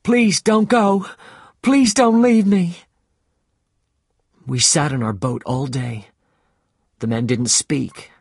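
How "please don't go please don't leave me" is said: much higher, loud, slow begging cry; boy begging the captain not to leave; pleading shout. Acted. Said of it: "He shouted to Captain Ahab".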